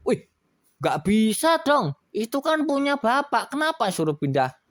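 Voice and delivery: high, loud refusal; indignant. Acted. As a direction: refuse it outright. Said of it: "katanya gitu". (marah menolak dengan suara tinggi)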